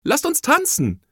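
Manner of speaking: high, eager shout; high-pitched invitation to play; child's excited call. A narrator's reading. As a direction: call out brightly to the other kids. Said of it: "rief eines der Kinder".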